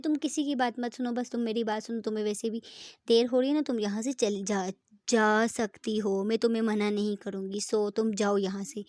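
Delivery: loud, huffy dismissal; angry sulk. Acted. Preeta angrily tells her leave.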